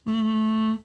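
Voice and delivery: long pondering hum; thinking sound. Shown as a demonstration. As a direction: draw out "mm" as if considering. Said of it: "can you make a thinking sound".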